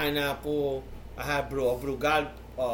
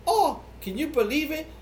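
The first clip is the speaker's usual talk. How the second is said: high-pitched mock disbelief; theatrical gossip, pitched up; host acting texts; 12 semitones higher than usual. Comedy host reading a woman's texts aloud, high mock disbelief. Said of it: "with her, he was like".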